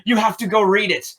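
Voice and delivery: high, fast, gushing praise; lightly voiced eager fan; enthusiastic lady. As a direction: sell the book like a superfan. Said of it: "It's like".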